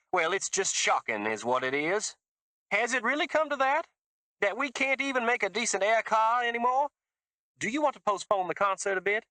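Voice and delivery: put-on high, gushing Southern accent; a fake Southern accent, high and gushing; fake Mississippi accent. A narrator's reading. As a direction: lay the fake Mississippi on thick. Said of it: "It was fake Mississippi".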